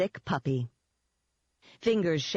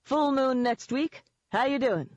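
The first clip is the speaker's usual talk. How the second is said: higher, bouncy phone hail; hearty greeting; 8 semitones higher than usual. Acted. Eden greeting Denon on the phone, hearty and higher. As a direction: greet an old contact with mock chumminess.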